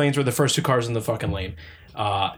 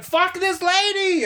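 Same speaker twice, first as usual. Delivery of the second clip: much higher, slow stretched-out curse; mock irritated; slower than usual. Driver retelling passing the slow woman, high scornful curse. Retold angry remark driving past.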